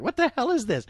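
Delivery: a high, incredulous "What the hell is this?"; baffled outburst. Retold or imitated. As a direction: react to something absurd. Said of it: "It was like".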